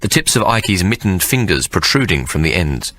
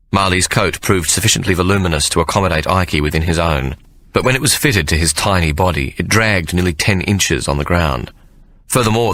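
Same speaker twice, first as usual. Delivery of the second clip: plain reading voice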